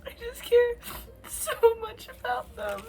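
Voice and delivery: high and breaking, half laughing and half crying; giggling through real sobs, voice up high; laughing through tears. Real, not acted. Reading alone, laughing and crying over the book. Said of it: "I'm laughing 'cause... I'm crying".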